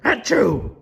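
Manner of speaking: high, sudden "achoo"; acted sneeze. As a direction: fake a loud sneeze. Gerald sneezes at the cat.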